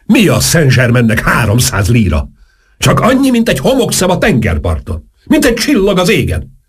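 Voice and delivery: high, grandiose count boasting; grand boast. A narrator's reading. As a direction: sweep it up like a poet. Saint-Germain boasting grandly. (magas, nagyzoló szónoklat)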